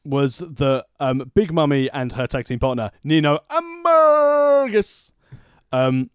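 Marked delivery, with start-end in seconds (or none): 3.0-5.1 "Ambergus" drawn out high and slow, mangled on purpose; deliberate mispronunciation. Acted. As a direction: mangle "Ambergus" like a clueless announcer. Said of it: "I'm Matt Strikering it".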